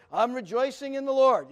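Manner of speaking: hearty, projected reply; loud testimony. Retold or imitated. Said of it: "didn't say it quite that loud".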